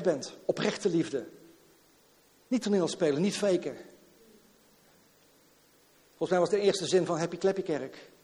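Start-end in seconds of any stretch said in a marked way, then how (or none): none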